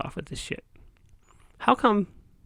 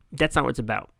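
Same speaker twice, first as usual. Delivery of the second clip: normal talking voice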